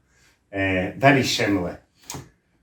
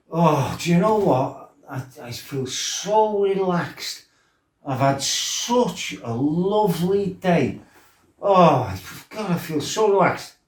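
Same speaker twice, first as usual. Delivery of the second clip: higher, slow dreamy sighing voice; blissed-out; 6 semitones higher than usual. Retold or imitated. Lad telling his mate how relaxed he feels. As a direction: melt into it, utterly relaxed.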